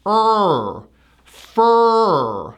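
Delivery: Er and fur on long held R; fur, drawn-out R; tight ER. Shown as a demonstration. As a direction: tighten and stretch Er, then fur. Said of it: "a tight er sound".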